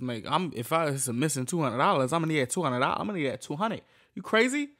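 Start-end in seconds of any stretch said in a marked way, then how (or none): 4.2-4.7 incredulous. Real, not acted. Incredulous about money.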